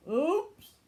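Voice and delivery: high, loud startled oops; Marshall's oops. A narrator's reading. Marshall splashing the gosling, a loud high oops. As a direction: blurt it like a clumsy pup. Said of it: "Marshall said".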